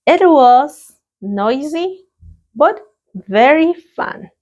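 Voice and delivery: slow, bright model answer; a cheerful classroom model answer. Shown as a demonstration. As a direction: lift the voice brightly on noisy and fun. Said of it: "Let's practice the pronunciation".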